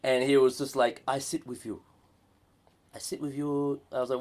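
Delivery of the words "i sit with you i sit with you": put-on Chinese accent. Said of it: "the Chinese accent".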